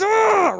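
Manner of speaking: high, drawn-out "ugh"; hammed-up high groan of regret; exasperated groan. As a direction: groan in mock agony. Groaning at having got angry.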